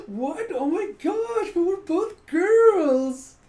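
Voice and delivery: very high, loud, slow squeal of disbelief; mock shocked girl. The other girl reacting to the proposal, shrill mock shock. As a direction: shriek it like a scandalized girl, drawing out the words.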